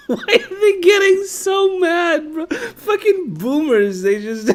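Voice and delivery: wheezing laugh while talking; laughing hard. Real, not acted. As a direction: crack up through the words. Laughing hard at boomer clip.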